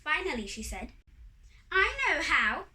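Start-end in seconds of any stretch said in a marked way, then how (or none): 1.7-2.7 high, loud triumphant exclamation; sudden bright idea. A narrator's reading. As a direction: announce it excitedly, pitched up. Wife suddenly has the idea.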